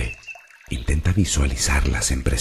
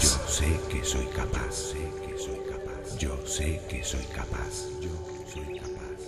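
much softer echo, receding into silence; fading echo; 12 dB quieter than usual. Acted. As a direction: repeat the affirmation like a distant, fading inner voice. Affirmation repeated as fading echo. (eco suave que se desvanece)

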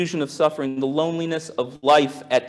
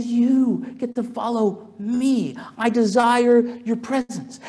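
slow, gentle welcome; tender invitation; 7 semitones higher, slower than usual. Retold or imitated. Jesus calling Mary to follow, slow and tender. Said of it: "And he had said".

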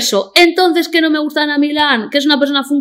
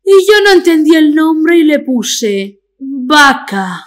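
Ana Milán impression; 4 semitones higher, slower than usual. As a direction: push the faked voice out slowly to the punchline. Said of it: "es una voz que finge".

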